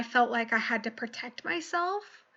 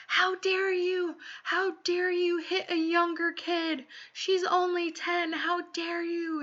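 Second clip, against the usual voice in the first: mock staff yelling; 6 semitones higher than usual. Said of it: "they were screaming in my face".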